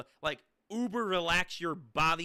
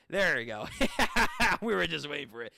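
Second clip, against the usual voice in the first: usual speaking voice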